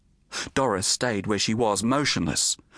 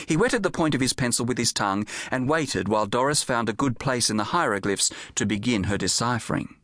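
ordinary delivery

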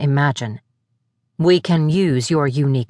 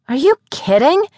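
high, sharp disbelieving question; stunned disbelief; 8 semitones higher than usual. A narrator's reading. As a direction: sound genuinely shocked. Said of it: "I gaped at Farron".

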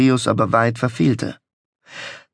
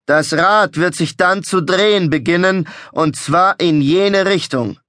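raised, theatrical carnival barker; showman voice; 7 semitones higher, 3 dB louder than usual. A narrator's reading. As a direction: proclaim it like a fairground showman. Showman announcing to crowd.

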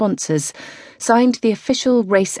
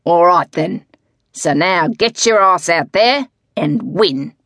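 rousing order; 4 dB louder than usual. Acted. Coach rousing her to race.

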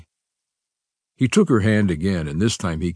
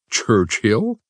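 squeaking surprise; 4 semitones higher than usual. A narrator's reading. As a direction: let the voice nearly squeak. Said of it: "almost squeaking in surprise".

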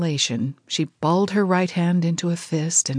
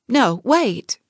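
higher, louder sudden plea to stop him; bursting out; 6 semitones higher, 4 dB louder than usual. Acted. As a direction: cry out to stop the door closing. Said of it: "Jessie burst out".

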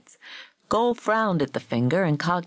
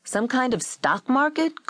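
higher-pitched snarling retort; irritated, snarly comeback; snarled; 8 semitones higher than usual. A narrator's reading. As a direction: snarl the question back. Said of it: "Gold snarled".

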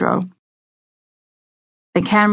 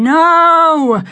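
sharp high shout; 11 semitones higher, 7 dB louder than usual. A narrator's reading. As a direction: yell it out to stop him.

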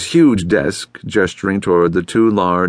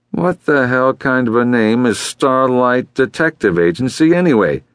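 higher, mildly exasperated challenge; understated irritation, pitched up; irritated client; 6 semitones higher than usual. A narrator's reading. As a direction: grumble at the name, a touch indignant. Rude client challenges receptionist.

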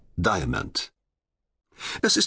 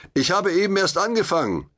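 high, louder call from above; defiant call from above; calling back down; 9 semitones higher, 3 dB louder than usual. A narrator's reading. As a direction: call down from the trapeze, brushing him off. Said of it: "rief ich".

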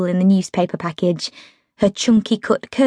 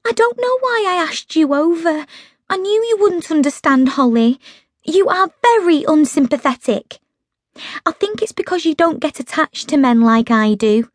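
higher, hurt and reproachful; Meg's aggrieved voice; 7 semitones higher than usual. A narrator's reading. Meg complaining to Holly, wounded and higher. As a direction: voice Meg sulking at her unsympathetic friend.

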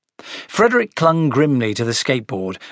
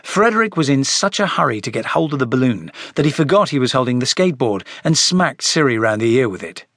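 ordinary delivery

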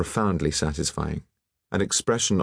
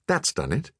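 higher-pitched, excited approval; 7 semitones higher than usual. A narrator's reading. Alain telling the painter it's done, excited. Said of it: "cried Alain excitedly".

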